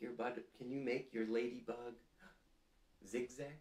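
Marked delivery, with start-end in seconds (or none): none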